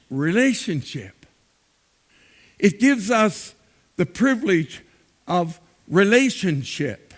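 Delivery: impassioned sermon delivery with swelling emphasis; preaching. Acted. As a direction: preach it to the congregation, rising on key words. Pastor preaching to congregation.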